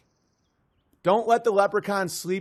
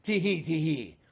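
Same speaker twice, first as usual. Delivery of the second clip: leprechaun giggle. Acted. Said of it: "And now I'm gone".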